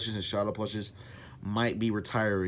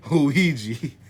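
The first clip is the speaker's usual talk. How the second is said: loud, higher, breaking from a laugh; 5 semitones higher, 7 dB louder than usual. Real, not acted. Introducing Luigi, a favorite character to voice, laughing. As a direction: say the name through a laugh. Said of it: "obviously I'm laughing".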